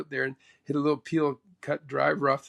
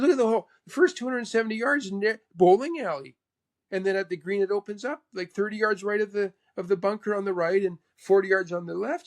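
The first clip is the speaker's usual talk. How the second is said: higher-pitched imitated explaining; 5 semitones higher than usual. Mentor describes the hole to player, imitated high. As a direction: lay out the hole in the mentor's lifted voice. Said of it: "He goes".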